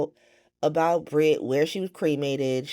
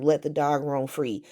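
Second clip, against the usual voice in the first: ordinary delivery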